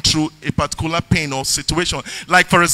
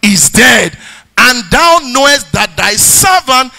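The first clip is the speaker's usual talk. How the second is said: raised, loud preacher's voice driving the sermon; preaching; 5 semitones higher, 5 dB louder than usual. Acted. Pastor preaching his testimony and scripture to the church, loud and fervent. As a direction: preach it full force to the congregation.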